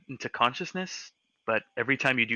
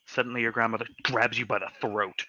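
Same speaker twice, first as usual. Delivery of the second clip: ordinary delivery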